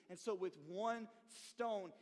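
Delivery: ordinary delivery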